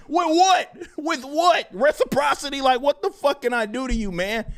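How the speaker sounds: high, exasperated questioning; incredulous protest. Retold or imitated. Streamer retorting to his accuser, incredulous protest. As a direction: demand an answer in baffled disbelief. Retells baffled answer to accuser.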